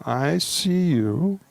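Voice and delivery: very slow and heartfelt, long pauses between phrases; slowly, with feeling. Shown as a demonstration. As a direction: let each phrase land, like a blessing. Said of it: "say it slowly and with feeling".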